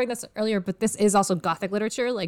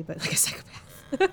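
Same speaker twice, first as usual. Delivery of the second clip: hushed; 6 dB quieter than usual. Real, not acted. Hesitantly names Dorian a psychopath.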